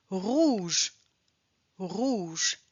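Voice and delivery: "rouge" as /ʁuʒ/, final e silent; rouge drilled. Shown as a demonstration. Teacher modelling the ou sound for learners. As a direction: say "rouge" twice, clearly. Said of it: "Rouge, on ne prononce pas le E".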